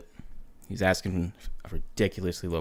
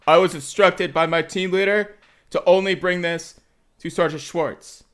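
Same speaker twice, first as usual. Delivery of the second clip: loud, high recital of orders; raised, by-the-book private's voice; dutiful private; 9 semitones higher, 5 dB louder than usual. Acted. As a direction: recite it like an anxious new recruit. Acting nervous private reciting orders.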